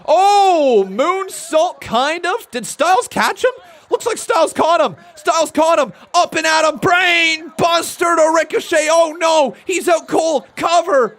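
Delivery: high, loud, frantic ringside shouting; live play-by-play. Real, not acted. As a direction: shout each move at full pitch as it lands. Calls a big move live.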